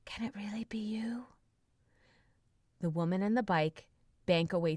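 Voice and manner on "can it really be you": soft unvoiced whisper. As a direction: whisper it in awe. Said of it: "whispers Dmitri".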